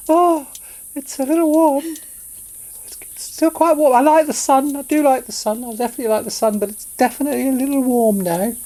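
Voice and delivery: high sunflower voice, growing uneasy; a cheery flower starting to wilt; sunflower getting warm. Shown as a demonstration. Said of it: "That's my impression of a sunflower".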